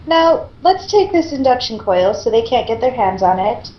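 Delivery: ordinary delivery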